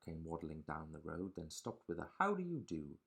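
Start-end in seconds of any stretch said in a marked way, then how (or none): 2.2-3.0 much higher, chirpy duck voice; duck's polite greeting. Acted. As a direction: greet sweetly as a little duck.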